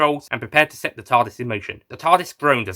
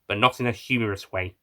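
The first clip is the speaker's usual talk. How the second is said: ordinary delivery, nothing marked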